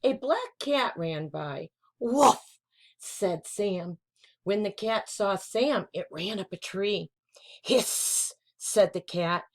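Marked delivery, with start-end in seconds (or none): 2.0-2.6 higher, louder playful bark; puppy woof. Acted. Puppy barks at cat. 7.6-8.3 mock cat hiss. Cat hisses in story.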